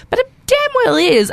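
high, pushed emphasis on damn well; damn well rising high; emphatic. Real, not acted. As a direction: insist it firmly. Emphatic feminist claim.

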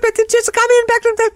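very high, frantic mock scream; retold scream. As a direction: squeal it out in a garbled panic. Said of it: "So I'm screaming".

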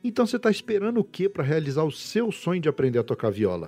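upbeat promo voice; ad read. Acted. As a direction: sell it like a radio spot. Host reads sponsor ad.

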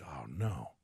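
breathless whisper of dread. Retold or imitated. Owner watching his puppy go still, a stunned whisper. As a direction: barely breathe it out as your heart drops. Dog goes motionless in lap.

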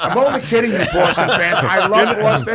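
high, loud laughter through the words; laughing and loud, only half serious; laughing apology. Real, not acted. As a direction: laugh while teasing the rival fans. Teasing Red Sox fans, laughing.

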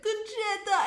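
high, loud waiter's cry of recognition; delighted greeting. Retold or imitated. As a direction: call out to a long-lost friend, hammed-up.

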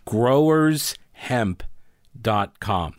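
slow, carefully spelled out; over enunciating. Shown as a demonstration. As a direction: say each word slowly and clearly. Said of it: "I'm gonna say it very slowly for you".

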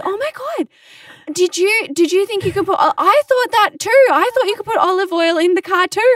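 high, excited and proud; proud airhead exclaim. Retold or imitated. Coworker proudly sharing her mistake, high and excited. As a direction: announce it like a proud discovery. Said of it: "proudly says".

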